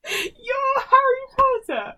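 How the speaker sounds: very high, loud, slow laughing cry; incredulous shriek. Real, not acted. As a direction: shriek at your friend in disbelief. Disbelief at friend's quiz result.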